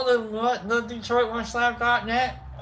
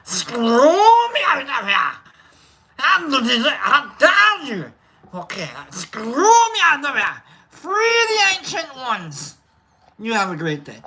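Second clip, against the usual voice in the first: mock Scottish accent; 2 semitones higher than usual. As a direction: roll it out like a stage Scotsman. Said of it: "we'll start with Scottish".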